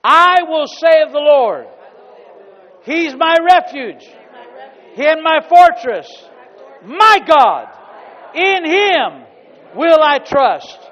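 loud, drawn-out scripture proclamation; booming pulpit reading; preaching at full force. Acted. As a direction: proclaim every word like a trumpet. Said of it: "say that with me".

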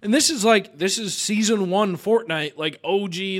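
normal talking voice

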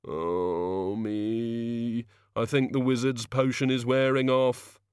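a woozy, hungover god voice; lightly voiced suffering god; groggy god. A narrator's reading. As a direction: drag it out like a miserable hangover.

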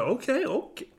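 high, bright imitation of a fan; mock hyped listener. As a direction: play the eager listener cutting off.

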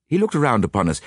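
usual speaking voice

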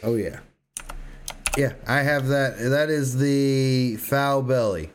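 normal talking voice

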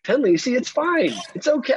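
very high, strained fake-happy voice; forced cheerfulness. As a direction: hold back anger behind a high, chirpy front. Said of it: "my voice is saying another".

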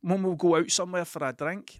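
mock phone call. Pretend call to Big Jim, mock-friendly. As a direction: ham up a cheery catch-up call. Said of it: "I'm ever gonna go".

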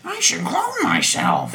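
very high, slow, laughing Marge impression; squeaky cartoon housewife; Marge Simpson voice.

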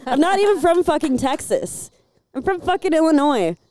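mock-offended, insistent and high; indignant protest. Retold indignant denial of accent.

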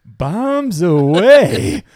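high, loud, long-held call; mock drawn-out shout. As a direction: holler it stretched out, hamming up the character. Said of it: "he goes".

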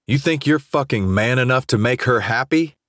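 high, taunting question with a rising end; scornful challenge. A narrator's reading. Jace taunts Jamal after shoving him, scornful.

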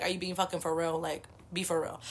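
incredulous aside. Retold or imitated. To the guard at the checkpoint, a quiet disbelieving deadpan. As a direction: quietly ask if they're serious, deadpan. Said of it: "it's like I'm giving you".

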